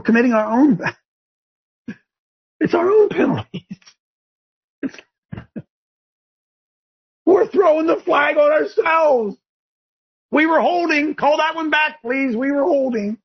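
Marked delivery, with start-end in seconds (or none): 2.6-12.4 excited rant. Real, not acted. Laughing rant about own penalties.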